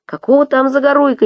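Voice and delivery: high, indignant call; crowd heckle. A narrator's reading. Passenger shouting at Murat, indignant. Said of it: "несётся из толпы".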